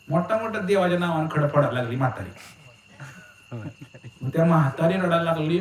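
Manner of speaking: usual speaking voice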